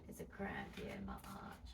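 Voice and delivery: hushed whisper through a cramp; whisper, strained by the cramp; whispering in pain. Real, not acted. Cramp in foot, patient whispering.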